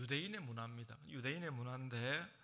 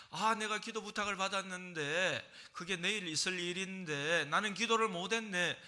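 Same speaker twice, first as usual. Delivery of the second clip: startled realization; 6 semitones higher, 8 dB louder than usual. Retold or imitated. Remembering unsaid prayer at night.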